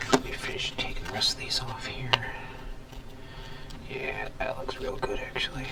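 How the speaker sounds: soft pleased whisper; hushed satisfied murmur. Real, not acted. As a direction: whisper to yourself while finishing the job. Whispering while scraping traces.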